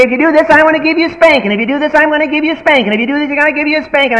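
high, fast sing-song threat; idle threat. Retold or imitated. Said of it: "So parents say".

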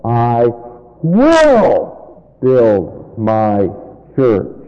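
slow, deliberate emphasis on "will"; stress on WILL. Shown as a demonstration. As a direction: pause after "I", then swell on "will". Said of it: "put the emphasis on the word will".

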